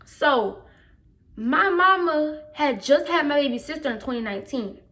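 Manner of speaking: ordinary talk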